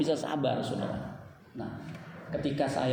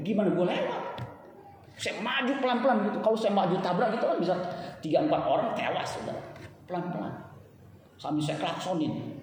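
ordinary delivery

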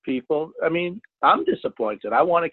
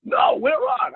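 excited shout; slower than usual. Retold or imitated. Mate shouting to the captain that a fish is hooked, excited yell. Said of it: "He goes".

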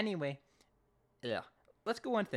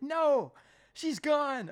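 much higher, louder clipped refusal; Eugene's curt no; 14 semitones higher, 7 dB louder, much slower than usual. Retold or imitated. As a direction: shut the question down in a high voice. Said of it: "Eugene's like".

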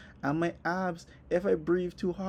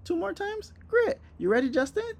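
put-on much higher, bright cheerful trainer girl; high chirpy Sarah. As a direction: chirp it like an overeager gym girl. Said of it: "this girl Sarah is just like".

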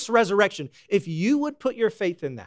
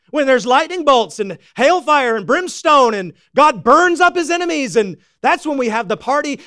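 loud, high, driving sermon voice; fiery preacher painting the scene; preaching at full force; 7 semitones higher, 6 dB louder than usual. Acted. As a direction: preach it out big and joyful.

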